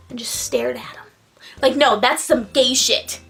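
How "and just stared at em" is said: soft whispered aside. Real, not acted. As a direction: whisper it creepily.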